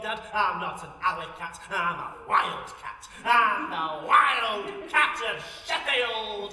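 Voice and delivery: building to a proud laughing shout; theatrical declaration. Retold or imitated. Comic woman acting her younger self defying her father, building to a shout. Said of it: "Got into character then".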